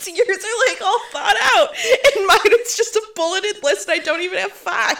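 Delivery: high, loud laughing talk. Real, not acted. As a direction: laugh through every word. Laughing at friend's bulleted list.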